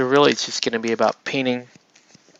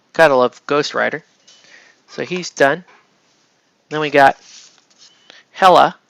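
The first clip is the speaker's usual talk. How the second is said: ordinary delivery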